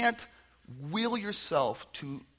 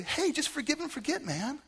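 mock breezy reply; 12 semitones higher than usual. Absurd reply to betrayed friend.

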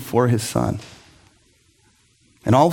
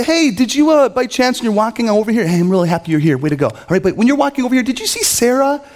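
high, sugary needling questions; fake-friendly digs at the son; passive-aggressive father; 7 semitones higher than usual. Father needling his son about the neighbor's dentist son, passive-aggressive. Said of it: "the passive-aggressive route".

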